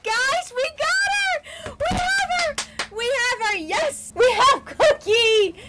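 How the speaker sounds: overjoyed squeals of victory; ecstatic shouting. Real, not acted. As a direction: scream with real joy at finally finding her. Finally finds the rare villager.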